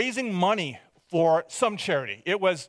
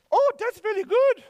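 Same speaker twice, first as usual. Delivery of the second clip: put-on high, louder accented nun; nun's accented voice; 4 dB louder than usual. Nun hearing about the boys' charity, high accented nun voice. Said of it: "why she had an accent".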